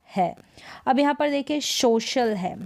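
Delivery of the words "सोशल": "सोशल" with sh in the middle; correct sh pronunciation. Shown as a demonstration. Teacher introduces the word social, correct sh pronunciation. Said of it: "श पढ़ेंगे".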